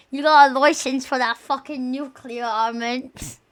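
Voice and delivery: put-on British challenge; mock British accent. Streamer mocking British people over licenses, mock British accent. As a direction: play a British heckler, accent laid on.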